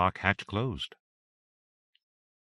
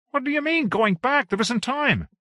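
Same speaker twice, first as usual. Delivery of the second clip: much higher, louder, protesting; alarmed Eric; 4 dB louder than usual. Acted. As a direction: voice him high and alarmed, pushing back. Alarmed captain argues with Valia.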